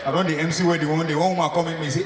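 normal talking voice

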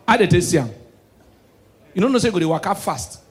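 ordinary talk

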